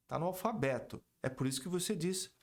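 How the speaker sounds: usual speaking voice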